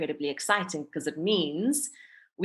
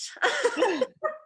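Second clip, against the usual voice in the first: a high, nervous laugh at herself; nervous giggle. Real, not acted. Laughs at her own fear.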